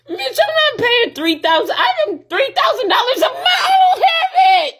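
breaking into a sobbing shout over rent; crying angry shout. Real, not acted. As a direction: scream it through tears.

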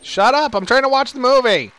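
loud, high mock shout over the film; mock heckling. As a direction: yell like an annoyed moviegoer. Mock heckling over game dialogue.

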